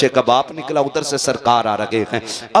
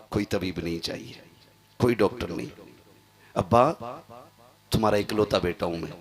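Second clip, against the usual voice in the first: weak dying voice; 6 semitones lower, 9 dB quieter than usual. Acted. Dying boy speaks weakly.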